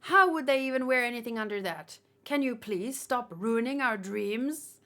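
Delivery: usual speaking voice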